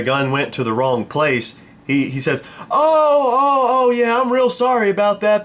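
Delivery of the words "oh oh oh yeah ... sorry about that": high, loud mock-sheepish voice; apologetic buyer. Gun buyer excusing a mix-up, high mock apology. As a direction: wail the apology in a put-on high voice.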